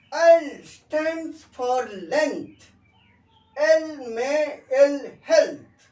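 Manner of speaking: slow, high, loud classroom rhyme; rhyme recitation. Shown as a demonstration. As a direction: recite it emphatically with gaps between phrases. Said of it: "এবার আমরা D তে আমরা রেখে দিচ্ছি".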